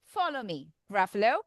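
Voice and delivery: low, soft, unbothered mouse; understated storybook mouse; cool mouse. Acted. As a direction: drop down quietly, calmly in charge. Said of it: "Then the mouse says".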